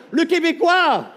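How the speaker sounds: high, loud, slow encouragement ending in a whoop; cheering crowd. Real, not acted. A comedian cheers on the Quebec delegate, loud high whoop.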